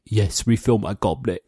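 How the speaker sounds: imitated, badly done British accent. Contestant answering the King Joffrey jibe, imitated bad British accent. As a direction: play along with a hokey British accent. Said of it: "how bad the accent is".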